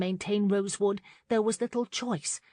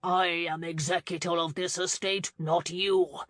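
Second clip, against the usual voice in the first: ordinary delivery, nothing marked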